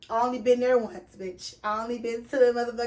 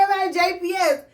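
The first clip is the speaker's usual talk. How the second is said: higher and louder, stressing the answer; insistent repeat; 6 semitones higher, 4 dB louder than usual. Retold or imitated. Teller repeating her answer to the nurse, insistent and louder. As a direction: insist on it like it's obvious.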